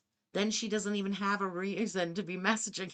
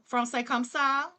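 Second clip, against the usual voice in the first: put-on Cajun French accent; 3 semitones higher than usual. Streamer imitating a Louisiana TikTok guy, Cajun French accent. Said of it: "That's totally Cajun".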